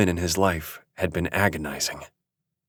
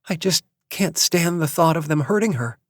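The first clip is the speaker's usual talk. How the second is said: mild distress; 9 semitones higher than usual. Acted. Distraught mother.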